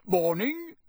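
cheerful, lifted morning greeting; bright greeting. Acted. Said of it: "said Mister Weasley brightly".

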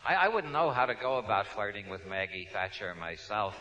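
usual speaking voice

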